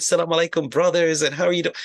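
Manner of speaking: beaming welcome from the new imam; smiling greeting. Retold or imitated. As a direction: greet the brothers with a big smile. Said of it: "The imam is smiling".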